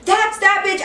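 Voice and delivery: high, accusing outburst; acted loud accusation; getting real loud. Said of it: "I started getting real loud".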